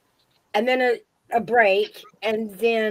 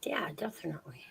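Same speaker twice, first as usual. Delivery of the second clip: whisper; 2 semitones lower, 15 dB quieter, faster than usual. Real, not acted. Whispered aside.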